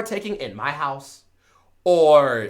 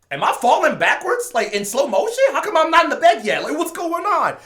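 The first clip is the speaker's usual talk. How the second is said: higher, bewildered rising questions; confused disbelief; 10 semitones higher than usual. Retold or imitated. Confused mid-fall self-talk.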